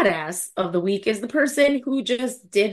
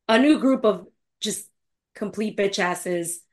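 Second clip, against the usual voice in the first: ordinary talk, nothing marked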